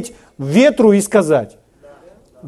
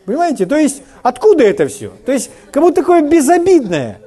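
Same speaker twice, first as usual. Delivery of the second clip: ordinary talk, nothing marked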